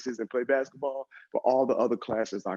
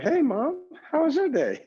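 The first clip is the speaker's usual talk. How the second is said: very high, louder put-on innocent voice; nervous fake-casual greeting; 3 dB louder than usual. Son greets mom while hiding bad grades, nervously over-sweet. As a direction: play a nervous kid sounding extra innocent.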